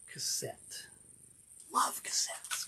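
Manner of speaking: hushed, voiceless aside; reverent whisper. Real, not acted. Collector admiring a freshly unboxed cassette, whispering. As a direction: whisper it, savouring the thing you love.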